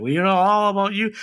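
put-on high, quick old New Englander voice; Bad Hat Harry. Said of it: "Bad Hat Harry goes to him ... he's like".

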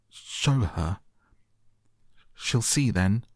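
understated tearful gasp, broken by a pause; gasped between sobs. A narrator's reading. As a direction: gasp it out in two bits, lightly tearful. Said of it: "he gasped out between two sobs".

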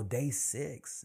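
normal talking voice

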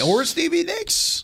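mock falsetto echo, drawn out; high-pitched echo. Host echoing co-host's [name] suggestion, high mock echo. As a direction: parrot it back way up high.

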